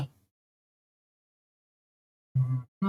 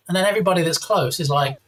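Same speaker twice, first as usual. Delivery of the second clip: normal talking voice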